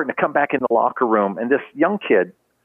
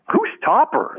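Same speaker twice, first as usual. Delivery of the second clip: high, innocent "who's Topper?"; puzzled question; 12 semitones higher than usual. Retold or imitated. Young player asking a veteran, high puzzled question. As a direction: rookie genuinely asking, up high.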